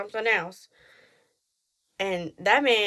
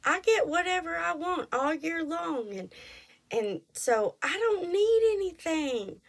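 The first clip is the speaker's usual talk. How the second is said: higher, lightly voiced husband; mimicking husband; 8 semitones higher than usual. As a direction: play the husband shrugging it off. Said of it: "But he's like".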